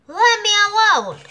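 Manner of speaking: high, loud angry roar. A narrator's reading. Drum owner drives the elephant away, roaring high. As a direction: roar at them to back off. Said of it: "roared the report".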